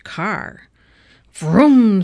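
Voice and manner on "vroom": revving car noise, loud and drawn out; big playful engine roar; loud engine vroom. A narrator's reading. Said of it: "said Mister Watson in his sleep".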